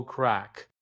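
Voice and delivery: ordinary delivery, nothing marked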